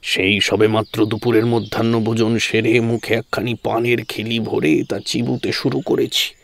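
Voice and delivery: ordinary delivery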